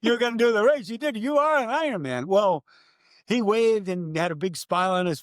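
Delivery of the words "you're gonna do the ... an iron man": higher-pitched triumphant announcer call, building; playful told-you-so. Retold or imitated. Announcer to the friend who doubted himself, gleeful told-you-so. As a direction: rub it in to a buddy, voice climbing. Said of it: "And I said it almost to, like".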